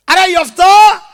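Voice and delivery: loud call across the road. Acted. Village elder calling out to Jephthah, loud call. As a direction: call him back, loud.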